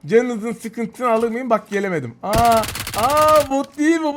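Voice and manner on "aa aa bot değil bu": slow, higher startled realisation; surprised. Real, not acted. Gamer realises the runner is a real player, surprised. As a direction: catch yourself in surprise.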